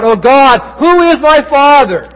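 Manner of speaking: loud, high-pitched anguished plea; agonized cry. Acted. Woman at her kitchen sink crying out to God, anguished. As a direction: cry it out to heaven in agony. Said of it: "In her agony, she cried out".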